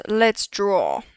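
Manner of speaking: ordinary talk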